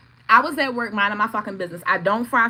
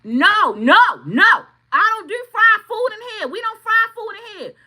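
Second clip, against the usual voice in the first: high, loud repeated no, then the rule; firm angry no; 13 semitones higher, 6 dB louder than usual. Retold or imitated. Telling the mother-in-law off, high and loud. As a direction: shut her down hard.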